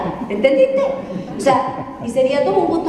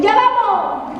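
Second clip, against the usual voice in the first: higher, offhand mock kid reply; kids calling back; 9 semitones higher, much slower than usual. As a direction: brush off mom with a lazy holler. Kids answer the dinner call. (hijos respondiendo a gritos sin moverse)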